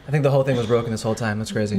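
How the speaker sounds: rapid loud outburst; loud and fast. Real, not acted. Excited outburst to co-host.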